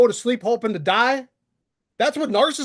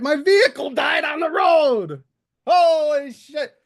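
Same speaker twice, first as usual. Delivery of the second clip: louder and higher, venting; frustrated outburst; 5 semitones higher, 4 dB louder than usual. Real, not acted. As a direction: let the frustration burst out. Venting about a bad week.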